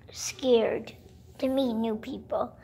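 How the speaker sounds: hushed whisper on the word scared. Pretend. Sister whispers that Katie is too scared to meet people. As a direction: drop to a whisper as if she might hear. Telling about a shy sister.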